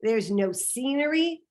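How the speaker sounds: plain reading voice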